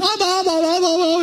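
very high, rapid mock squeals; freaked-out child. Girl taken across the pool by daddy, freaked-out squealing. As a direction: imitate a panicking little girl. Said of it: "she just freaked out".

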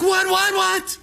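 high, loud startled outburst; mock panic. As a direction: act out a panicked driver. Startled husband in retold scare.

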